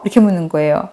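normal talking voice

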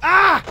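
short, very loud high shriek; startled scream. Real, not acted. Player jumped by the game, startled shriek. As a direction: scream in sudden shock.

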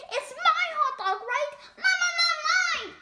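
greedy pigeon. Acted. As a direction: grab it back like a greedy child. Pigeon claiming hot dog.

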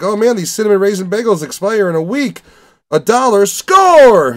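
mock-thrilled bargain find, rising to a loud squeal; hammed-up kid delighted at a cheap find; excited. Excited bargain-hunting kid voiced.

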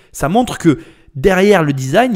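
ordinary delivery

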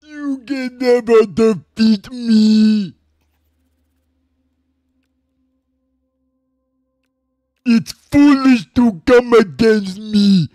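loud, high villain taunts; booming villain. Acted. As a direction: boom it like a game boss.

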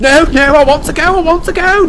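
very high, loud wailing plea; panicked wail. Acted. James begging to flee the ghost, wailing. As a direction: wail in terror to get away. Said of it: "James wailed".

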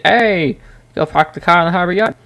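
high, hammed-up Bostonian greeting; Boston accent. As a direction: call out quickly in a thick mock Boston accent. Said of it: "definitely a northern accent".

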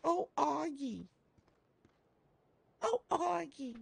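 very high, playful little call; drawn-out high falsetto coo; high-pitched cooing. Pretend. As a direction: coo the nickname in a tiny high voice. Cooing over a gun's nickname.

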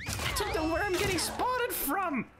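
real flustered outburst, voice shooting very high; high-pitched frustration. Real, not acted. Player baffled at getting spotted, high frustrated squeal.

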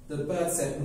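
ordinary delivery, nothing marked

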